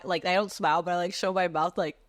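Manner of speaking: ordinary delivery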